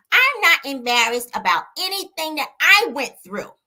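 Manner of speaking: high, slow, drawn-out impression, pushed loud; Mel's voice. Reading Melody's words in her voice for viewers, high and drawn out. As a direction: imitate a singer's testimony with the pitch way up. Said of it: "can I read it in her voice".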